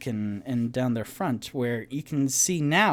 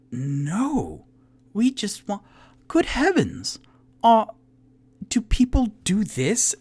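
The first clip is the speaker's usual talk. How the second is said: much higher, louder, appalled gentlemanly voice; appalled Toot Toot; 10 semitones higher, 6 dB louder than usual. Acted. Toot Toot is horrified by the skewering tools, high appalled voice. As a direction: recoil in polite horror.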